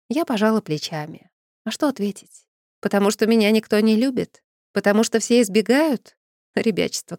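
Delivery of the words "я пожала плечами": ordinary delivery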